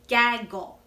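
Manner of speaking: "gaggle" in two clear beats, gag and gle; syllable by syllable. Shown as a demonstration. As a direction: separate "gaggle" so both syllables can be counted. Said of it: "How many syllables do you hear?".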